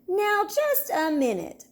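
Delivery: high, slow, indignant objection; protesting. A narrator's reading. Sister protesting at Lizzie playing teacher, slow and high. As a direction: stop her bossy friend cold. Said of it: "protested Sister".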